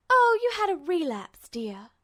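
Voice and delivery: warm nurse. Acted. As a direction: talk down to him like a child. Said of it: "she told him warmly".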